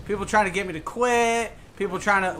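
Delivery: ordinary talk, nothing marked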